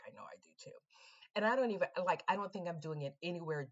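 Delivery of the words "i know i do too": whispered, awed reply; soft awestruck whisper; hushed whisper. Retold or imitated. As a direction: whisper it, overcome with chills.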